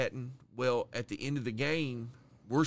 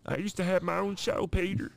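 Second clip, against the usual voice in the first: hammed-up Cleveland, much higher, talking to Peter; a cartoon dad teasing Peter; 7 semitones higher than usual. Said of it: "The Cleveland Show".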